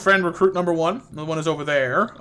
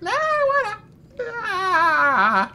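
very high, slow, whiny crying wail; high sobbing little gnome; 3 dB louder, much slower than usual. Retold or imitated. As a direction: voice the gnome bawling like a toddler. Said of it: "He's like".

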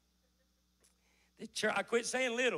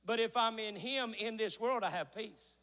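usual speaking voice

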